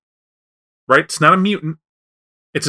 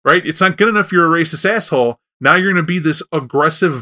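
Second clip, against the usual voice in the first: ordinary talk, nothing marked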